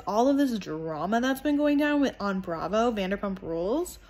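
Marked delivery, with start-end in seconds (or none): none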